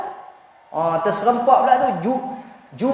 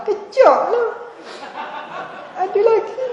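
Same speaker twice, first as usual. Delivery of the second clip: high, teary mock woman's voice, laughing; high crying woman; 12 semitones higher than usual. To the ustaz, a sobbing wife stalls in a high whine. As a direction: squeak it out like a weeping wife stalling.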